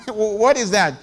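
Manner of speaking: laughing at the claim; laughing disbelief. Real, not acted. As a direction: chuckle through the question. Mocking laugh.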